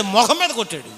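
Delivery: ordinary talk, nothing marked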